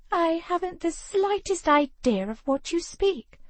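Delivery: a hint of a snobbish aristocrat; haughty lady. A narrator's reading. Penelope feigning innocence.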